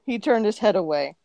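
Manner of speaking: ordinary delivery, nothing marked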